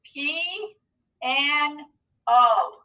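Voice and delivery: "P N O" as held syllables pee, an, oh, paused between; broken into syllables. Shown as a demonstration. Teacher breaking piano down for pupils, syllable by syllable. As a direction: sound out pee... an... oh, beat by beat. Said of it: "Let's break that into syllables".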